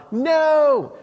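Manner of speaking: high, loud mock British no; Monty Python style British reply; British accent. Fishermen answering Jesus in a hammed-up British accent. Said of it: "I'm thinking like Monty Python".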